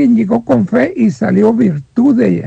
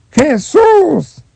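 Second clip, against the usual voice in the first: a raised, pleading call of the name; crying out; 6 semitones higher than usual. Retold or imitated. Preacher acting out the blind man's cry, raised and high. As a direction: call out Jesús! high, like a blind man begging. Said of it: "Y gritó a todo pulmón".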